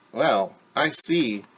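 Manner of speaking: ordinary talk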